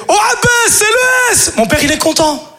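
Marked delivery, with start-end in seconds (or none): 0.1-1.5 very high shouted call; hammed-up teasing kid; imitating little brother. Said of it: "il fait". (frère qui appelle le père par son prénom, voix très aiguë)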